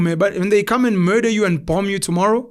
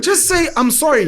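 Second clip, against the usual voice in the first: emphatic sorry; 7 semitones higher than usual. Shown as a demonstration. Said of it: "Say sorry".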